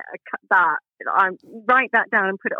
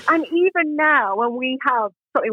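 ordinary delivery

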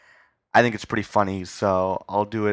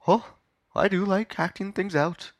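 very high, slow, affected interviewer; interviewer character; 11 semitones higher than usual. Acted. [name] agreeing to act out the skit, delighted. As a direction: savour it as a high-voiced, pompous host.

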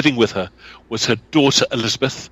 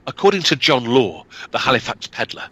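usual speaking voice

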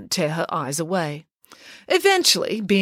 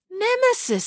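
puzzled query; 13 semitones higher than usual. A narrator's reading. Boy queries an unknown word.

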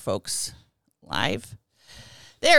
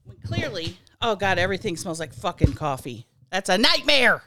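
ordinary talk, nothing marked

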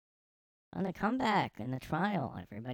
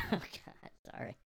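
ordinary talk

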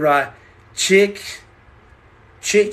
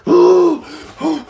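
loud, high gasp and quick puffs; sharp mock gasp; 10 semitones higher, 5 dB louder than usual. Acting out moments before the live, panicked gasping. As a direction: gulp air in hammed-up panic. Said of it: "I go".

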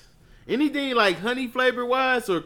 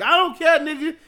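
mock annoyed reply; 9 semitones higher, 5 dB louder than usual. Voicing her annoyed reply.

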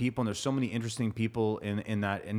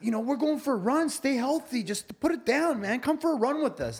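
high, friendly persuading voice; much higher cheerful coaxing; 13 semitones higher, 3 dB louder than usual. Acted. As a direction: talk him into it, pitched way up. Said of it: "And he's like".

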